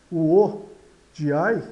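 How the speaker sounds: ordinary delivery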